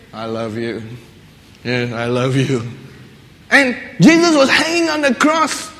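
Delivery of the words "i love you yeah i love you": low, soft, gentle I love you; tender love. Pretend. Jesus on the cross tenderly telling soldiers he loves them. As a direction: say it softly as a quiet gift. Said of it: "he could say".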